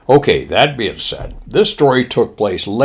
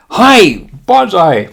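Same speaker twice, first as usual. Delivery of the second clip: high, loud mock-Japanese chorus; Japanese pilots' shout; 10 semitones higher, 6 dB louder, much slower than usual. Pilots answering their admiral, high mock-Japanese shout. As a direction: shout it like obedient recruits. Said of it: "going back and forth between".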